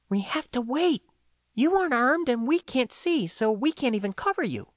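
cautious partner holding him back; Floy's voice. Acted. Floy voiced by the narrator.

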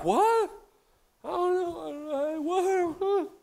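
high, bewildered husband; clueless, helpless what what; a confused husband at a loss; baffled what. Retold or imitated. Husband baffled by crying wife.

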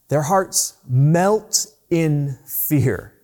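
Their hearts melt in fear, each word slow and weighted; slow emphasis. Shown as a demonstration. Preacher wants the congregation to hear the phrase, slow and weighty. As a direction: lay out the phrase slowly so it sinks in. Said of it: "I want you to hear that phrase".

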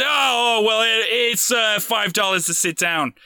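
put-on high, slipping Boston-Australian accent; botched Boston accent turning Australian. Doorman naming the price to sit, Boston accent gone Australian. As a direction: fake Boston and drift into Australian. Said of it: "he turned into an Australian".

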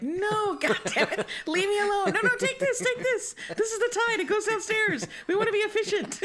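shrill parody of the wife's protest; mock wife. Parodying the wife's efficiency protest in a high mock voice. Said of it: "She's like".

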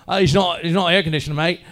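badly faked British accent; botched local accent. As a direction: imitate the hotel staff in a wrong British accent. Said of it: "That's not even the right accent".

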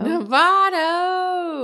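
high, loud, drawn-out holler; playful echo. Pretend. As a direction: belt out the place name in mock triumph. Playfully echoes co-host's place name.

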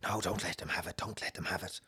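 imitating an urgent, hushed plea; voice of unseen puller. Unseen force begging its rival, hushed imitation. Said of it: "whatever was pulling at the other side, it was like".

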